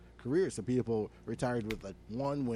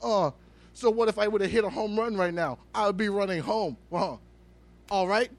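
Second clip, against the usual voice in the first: higher, louder, whining mockery of the batter; mock him as a petulant player, pitched up; imitating an indignant ballplayer, higher and louder; whiny player impression; 6 semitones higher, 4 dB louder than usual. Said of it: "He ran over and was like".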